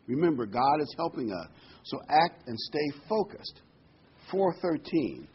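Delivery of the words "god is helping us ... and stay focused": ordinary talk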